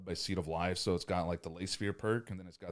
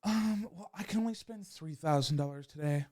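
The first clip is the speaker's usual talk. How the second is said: much higher, timid customer voice; higher coy voice admitting a budget; hesitant customer; 8 semitones higher than usual. Retold or imitated. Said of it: "and they're like".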